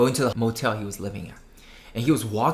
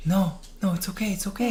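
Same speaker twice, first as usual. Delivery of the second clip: high, airy reassurance; acted soothing; 7 semitones higher than usual. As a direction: play a man hushing a scared child. Man soothes frightened girl in story.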